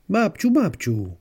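put-on high, loud, slow child's call; little grandson calling. Grandson calls his distracted grandma, high insistent child voice. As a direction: whine for grandma's attention in a small child voice.

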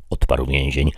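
usual speaking voice

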